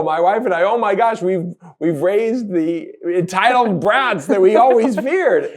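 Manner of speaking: high, loud mock horror; hammed-up dismay, voice pushed up and loud; appalled parents. Dad recounting the parents' shock at their son's outburst, appalled.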